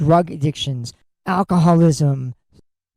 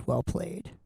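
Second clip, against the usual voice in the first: dropped almost to nothing, under the breath; muttered repeat; 14 dB quieter than usual. Real, not acted. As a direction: say it again to yourself, barely audible. Repeats the toast under his breath.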